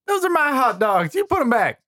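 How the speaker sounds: high-pitched holler at a thief; indignant yell. Acted. Camper orders creature to return his hot dogs, indignant yell. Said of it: "He yelled".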